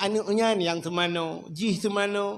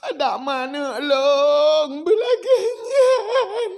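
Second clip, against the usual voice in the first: wailing; 7 semitones higher, 7 dB louder, much slower than usual. Retold or imitated. Crying out in grief.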